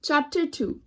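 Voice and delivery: plain reading voice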